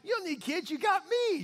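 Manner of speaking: hammed-up oblivious boast; clueless husband. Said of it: "He's like".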